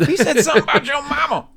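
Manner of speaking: high mock instigator voice; egging them on. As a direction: stir up a fight, hammed up. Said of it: "trying to egg 'em on".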